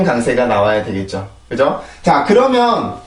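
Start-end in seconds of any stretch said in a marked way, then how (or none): none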